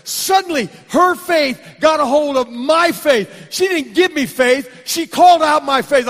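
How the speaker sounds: loud, high preaching climax; full-force preaching. Acted. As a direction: preach it to the rafters.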